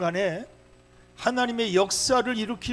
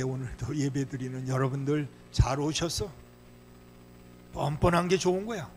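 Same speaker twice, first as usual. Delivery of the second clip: normal talking voice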